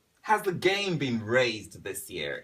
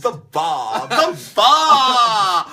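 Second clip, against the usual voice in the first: loud, rising mock-British cry; delighted mimicry; 10 semitones higher, 14 dB louder, much slower than usual. Host echoing the guest's British word, delighted shout. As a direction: crow it louder the second time, drawn out.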